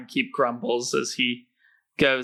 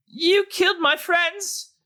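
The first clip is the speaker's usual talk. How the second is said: high, slow outraged rogue; 4 dB louder, slower than usual. Acted. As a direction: cry out accusingly in a high voice. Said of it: "the rogue is like".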